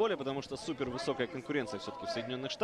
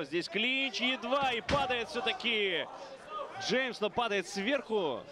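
excited commentary; 8 semitones higher, slower than usual. Real, not acted. Calling a takedown live.

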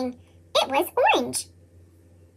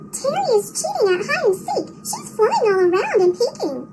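put-on higher, tattling child's voice; tattling little dinosaur; 5 semitones higher, 6 dB quieter than usual. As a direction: tell on a friend in a squeaky kid voice.

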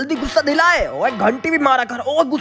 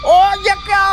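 high and louder sudden cry; startled exclamation; 6 semitones higher, 4 dB louder, slower than usual. Real, not acted. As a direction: burst out in shock. Teacher reacts strangely. (अचानक ज़ोर से चौंकना)

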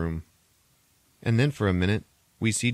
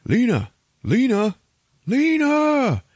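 high, louder ghostly calling of Lena; 7 dB louder, slower than usual. Retold or imitated. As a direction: call her name like a haunting echo.

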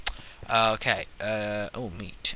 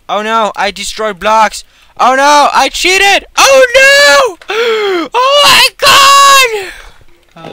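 high shrill-free panicked shouts, much higher and louder; mock panic; 18 dB louder, much slower than usual. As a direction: squeal out in hammed-up alarm at your own mistake. Panicked after breaking blocks.

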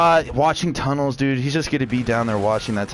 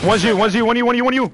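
urgent warning; 10 semitones higher, 5 dB louder than usual. Real, not acted. Warning teammate of enemies.

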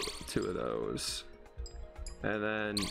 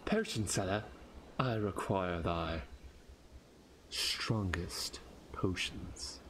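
imitating the knight; 5 semitones lower, 4 dB quieter, slower than usual. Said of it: "That was beautiful".

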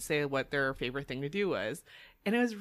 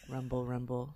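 sultry; 7 semitones lower, 8 dB quieter than usual. Pretend. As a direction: say it like seduction. Sexy voice about food poisoning.

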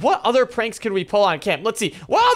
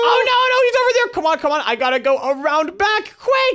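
rushing to get around back; frantic; 8 semitones higher than usual. Real, not acted. Cam spots Ruby, [name] rushes.

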